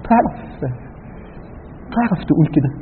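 usual speaking voice

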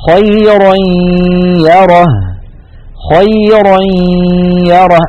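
خيرا يره chanted as "khayray-yarah" with a nasal hum; slow Quranic chanting of خيرا يره; tanween merged with ghunna. Shown as a demonstration. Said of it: "غنة وياء في آن واحد".

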